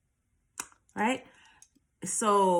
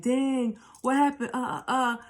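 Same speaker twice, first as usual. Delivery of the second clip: mock freaking out; 5 semitones higher than usual. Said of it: "he was freaking out".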